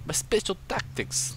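high, light attempt at a Swedish accent; fumbling a Swedish accent that comes out Russian; mock Swedish accent. Commentator tries a Swedish accent for the player's name, mock Swedish accent. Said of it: "What's the Swedish at-, accent?".

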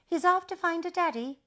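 high, loud teddy voice. A narrator's reading. Teddy telling everyone the baby is off, high loud voice. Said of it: "the teddy said".

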